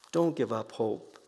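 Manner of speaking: dropped soft, dont lightly stressed; soft, intimate repeat; hushed. Shown as a demonstration. To the congregation, a soft tender echo. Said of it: "repeated line, second take hushed".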